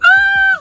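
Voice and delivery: high sobbing gasp; mock crying gasp. Calling best friend in distress, high mock sobbing gasp. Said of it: "I'm crying so bad".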